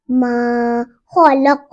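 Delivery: मा खलक said very slowly with a full, heavy kha. Shown as a demonstration. Teacher shows the full kha in मा खलक slowly. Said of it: "खो को पुर पढ़ेंगे".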